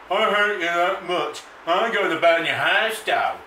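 angry. Acted. Ranting threats at the audience.

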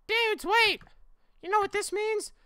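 very high, loud, excited squeal; excited squeaky dude. Acted. Friend realizing a girl exists, high squeal. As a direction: shout it like a squeaky teen.

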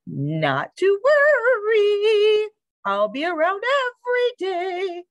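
high, loud, trilling reassurance; chirping bird. A narrator's reading. Bird promises the dog she'll return daily, chirping high. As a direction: chirp it high, stretching the vowels. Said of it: "chirped the bird".

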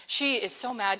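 normal talking voice

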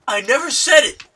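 indignant denial. Retold or imitated.

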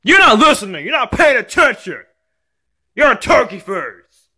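high-pitched scolding protest; angry kid. Acted. Kid accusing dad of not listening, angry. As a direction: tell dad off in a high bratty voice.

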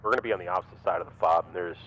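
terse radio update. Real, not acted. Urgent radio report.

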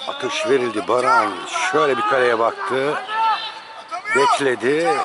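live sports call, measured build; play-by-play commentary. Acted. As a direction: call each penalty as it happens. Live penalty shootout commentary.

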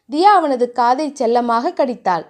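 plain reading voice

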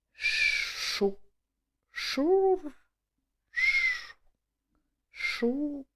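soft, drawn-out hushing rustle; shushing sound effect, spaced out; imitated corn rustle. A narrator's reading. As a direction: imitate dry leaves whispering. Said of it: "шурхотить кукуруза".

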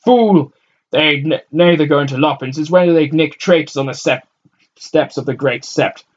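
loud, high scornful retort; scornful arguing. A narrator's reading. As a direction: shout down the fool. Crowd man arguing loudly.

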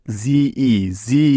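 জি said slowly with a long, held ee; long vowel sound. Shown as a demonstration. Teacher asks students about the syllable, long-vowel demonstration of জি. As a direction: draw out the ee in জি, very slowly. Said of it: "ই ভাওয়েলটার এখানে long sound হয়েছে".

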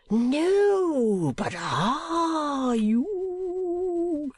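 high, wailing, drawn-out plea; very slow, singsong old oddball; eccentric old gentleman. Acted. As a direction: swoop up and stretch the words out. Old gentleman presses his eccentric question.

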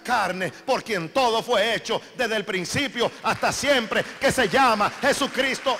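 loud, high fiery sermon; preaching at full force. Acted. Preacher exhorting the congregation, loud and fervent.

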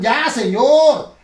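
loud, slow stop shout; loud cut-off. Acted. Cutting off the dummy's insult, a loud slow shout. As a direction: stop him before the insult, loud and drawn out.

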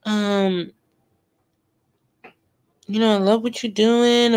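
high mocking gush of false praise; fake flattery. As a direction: imitate a fake friend gushing.

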